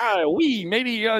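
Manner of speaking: a hammed-up Scottish brogue; thick Scottish accent. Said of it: "with his, you know, thick Scottish accent".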